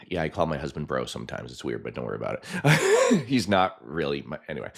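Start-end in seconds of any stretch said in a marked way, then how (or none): none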